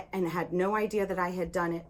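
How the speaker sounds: ordinary delivery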